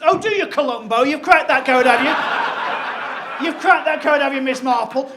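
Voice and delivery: loud sarcastic shouting; mocking outburst. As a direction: jeer loudly at the midwife in panic. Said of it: "she bristled at this point".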